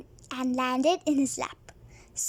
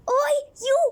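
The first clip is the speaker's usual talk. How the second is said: higher, louder "Oi, you!"; yell; 9 semitones higher, 5 dB louder than usual. A narrator's reading. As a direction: shout it at the kid. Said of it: "yelled Sergeant Slipper".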